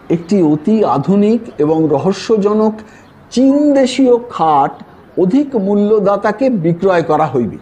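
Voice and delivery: ordinary delivery, nothing marked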